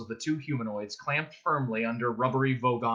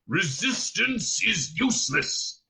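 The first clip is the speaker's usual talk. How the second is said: slow, higher-pitched monster bellow; Vogon guard shouting; 6 semitones higher, slower than usual. A narrator's reading. Guard shouting back at his prisoner, bellowing slowly. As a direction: drag out each word as a stock threat. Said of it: "shouted the Vogon guard back at him".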